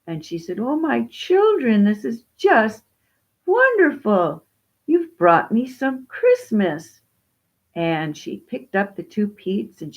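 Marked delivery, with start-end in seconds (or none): 0.6-7.0 high, loud, gushing thanks; raised, swooping old lady's voice; overjoyed old widow. Acted. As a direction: draw it out with delighted wonder.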